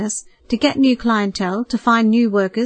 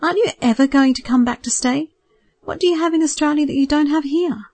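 higher, lightly pleading mother; a mild plea, voice raised in pitch; begging; 6 semitones higher than usual. Acted. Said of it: "she begged".

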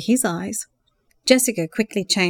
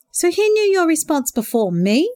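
mock dismay; 9 semitones higher, 4 dB louder than usual. A narrator's reading. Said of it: "exclaimed with mock dismay".

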